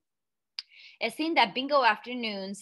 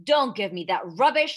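loud, dismissive shout; father dismissing Matilda's excuse, shouting; 8 dB louder than usual. Acted. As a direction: shout her down. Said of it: "the father shouted".

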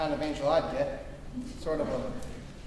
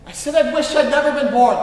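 bellowed in bitter anger, much louder; angry shout; 8 semitones higher, 14 dB louder than usual. Acted. George shouting his wish back at the angel, furious. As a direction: shout it back at him, fed up.

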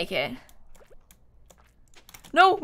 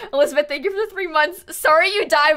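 ordinary delivery

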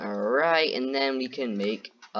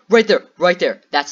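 excited pointing; 6 semitones higher, 10 dB louder than usual. Real, not acted. Showing viewers spotted Herobrine.

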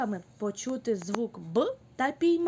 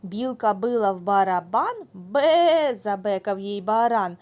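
loud, bouncy recitation of a nursery rhyme; lively loud rhyme reading; children's rhyme; 7 dB louder than usual. Acted. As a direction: chant the rhyme for little kids.

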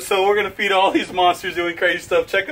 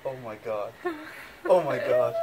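ordinary talk, nothing marked

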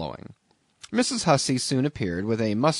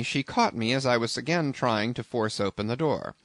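usual speaking voice

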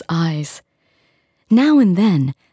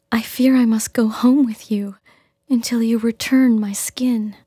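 resigned selkie woman; 6 semitones higher than usual. Acted.

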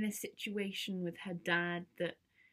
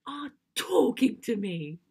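loud, emphatic excited burst; thrilled teen; 4 semitones higher, 11 dB louder, slower than usual. Acted. Teen telling Dad the coolest girls notice her, thrilled.

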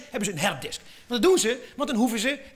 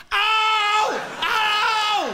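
high, loud yelp of pain; pained cry; 10 semitones higher, 5 dB louder than usual. Pretend. Squeezed computer crying out in pain, hammed-up yelp. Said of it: "En gemeend, hè?".

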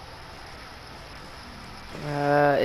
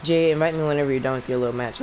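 normal talking voice

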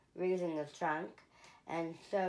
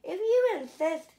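rhino voice; 11 semitones higher, 7 dB louder than usual. A narrator's reading.

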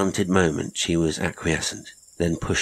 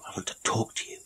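breathy half-whisper, much softer; whispered plea; 5 semitones higher, 8 dB quieter, faster than usual. Acted. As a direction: confide it under your breath. Man pleading with Inez.

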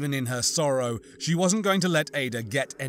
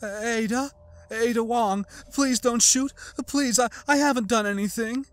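much higher, pleading, stuttering act; faked stammering fear; 10 semitones higher, 3 dB louder than usual. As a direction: play the terrified innocent. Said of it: "putting a stutter into her voice".

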